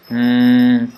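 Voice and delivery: nasal hum with lips closed; labial hum. Shown as a demonstration. Teacher demonstrating labial nasal with a hum. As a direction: hum through the nose, lips shut. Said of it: "ma is right at the lips".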